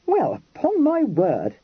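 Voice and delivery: slow, offended huff; affronted. A narrator's reading. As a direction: bristle with wounded dignity. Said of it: "he exclaimed, affronted".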